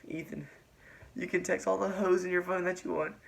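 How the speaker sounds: high-pitched mock tearful breakup; imitated crying. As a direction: mimic a girl half crying as she dumps someone. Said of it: "kinda crying".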